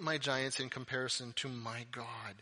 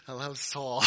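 ordinary talk, nothing marked

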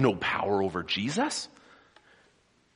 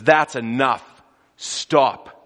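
a loud, stern command to the storm; 3 semitones higher, 9 dB louder, much slower than usual. Acted. Jesus commanding the storm to stop, loud. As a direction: order the storm to stop, full voice. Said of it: "and he says".

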